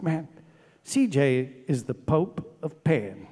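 a hint of the friend's voice, slowly and softly; imitating a friend. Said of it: "He goes".

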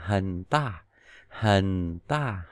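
"hình ta" low and falling; third tone low fall. Shown as a demonstration. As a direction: drop "hình" low like dấu huyền. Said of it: "thanh ba là dấu huyền".

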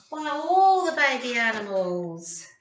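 ordinary delivery

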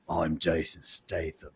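slow, low, mock-American movie-star voice; Jason Statham. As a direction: drop it deep and drag it out. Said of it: "it's just lower".

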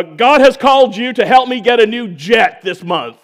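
loud mock-preacher proclamation; booming parody of a fundraising preacher; mock televangelist. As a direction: proclaim it like a showy money-seeking preacher. Mock preacher begging for a jet.

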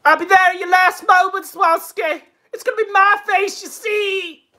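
high, hammed-up menacing impression; mock-dramatic high cry; Ethan Ralph. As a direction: threaten in a high put-on voice. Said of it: "He can't even do that anymore".

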